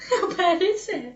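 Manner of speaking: high laughing repeat of the question; laughing echo. Real, not acted. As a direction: giggle the words back at her. Echoes sister's question laughing.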